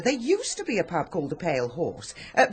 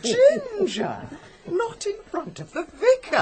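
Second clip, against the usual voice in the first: mock shock; 12 semitones higher than usual. Mock-scandalised rebuke.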